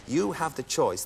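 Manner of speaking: normal talking voice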